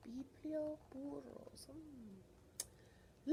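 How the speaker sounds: hushed secret reveal; whisper. Real, not acted.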